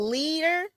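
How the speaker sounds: leader, said as one word, LEE-der. Shown as a demonstration. Host reading the discount code to viewers, clear leader. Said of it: "leader, not lead her".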